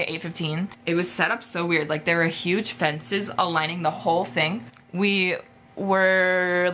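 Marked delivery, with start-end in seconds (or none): none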